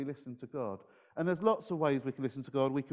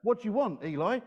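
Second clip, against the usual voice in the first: higher-pitched, lightly acted questioning; a hint of a woken boy, pitched up; Samuel, lightly voiced; 7 semitones higher than usual. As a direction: lightly play a boy asking what he's wanted for. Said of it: "he went to this guy called Eli and he said".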